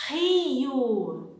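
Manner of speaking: Rio with a throaty h-like R, like hee-oo; Portuguese R. Shown as a demonstration. Teacher modelling Rio for students to repeat. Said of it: "Repeat after me".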